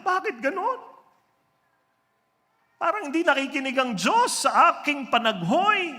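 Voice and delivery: high, loud tearful lament; imitating a crying woman's plea. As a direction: wail it like a weeping woman asking why. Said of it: "Ang umiiyak at sabi niya".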